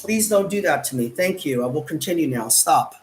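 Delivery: ordinary talk, nothing marked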